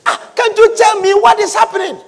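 high-pitched, pleading question; exasperated plea. Retold or imitated. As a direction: press the crying man for answers. Bystander pleading with crying man.

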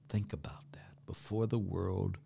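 whisper. Real, not acted. Hushed point in a homily.

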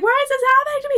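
very high imitation wail; mock sobbing. Friend replaying Anna's grief for laughs, mock sobbing. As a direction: put on a high, tearful whine. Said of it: "sobbing over all these things".

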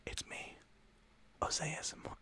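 hushed unvoiced whisper; whispered ASMR self-introduction. Shown as a demonstration. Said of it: "your A S M R artist name".

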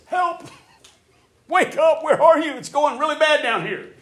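loud, high, frantic plea; the psalmist crying out loud in distress; desperate cry for help. Retold or imitated. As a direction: shout up at a sleeping God in panic. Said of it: "So many of David's psalms start out like this".

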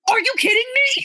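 high, disbelieving; incredulous. Retold or imitated. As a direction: react in outraged disbelief. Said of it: "And I was like".

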